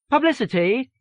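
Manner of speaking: high-pitched phone answer; publicity assistant. A narrator's reading. Publicity staffer answering a transferred call, high-pitched. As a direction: announce the department in a high voice. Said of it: "a voice said".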